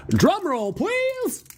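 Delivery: hype call. Acted. Building hype before reveal.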